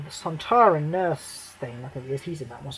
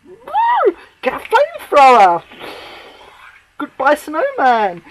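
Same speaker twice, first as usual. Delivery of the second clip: mocking cheer; 8 semitones higher, 7 dB louder than usual. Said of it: "It's like".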